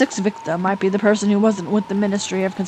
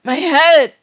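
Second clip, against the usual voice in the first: loud, high cry of pain; 5 semitones higher, 6 dB louder than usual. Acted. Sharp pain races through Mion's head, pained cry. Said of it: "A sharp pain races through Mion's head".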